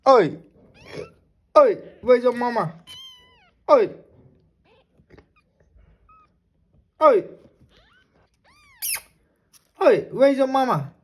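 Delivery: high, loud sing-song calling to a pet; high pet talk. Acted. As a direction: coo up high at the little animal like a baby.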